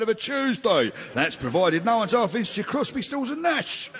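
hammed-up cockney rhyming slang; cockney banter. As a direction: reel off slang like a barrow boy. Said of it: "cheeky cockney banter".

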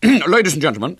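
high, loud showman's call; big, high-pitched presenter voice; young showman William. Acted. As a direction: announce to the whole hall. William continues his show announcement.

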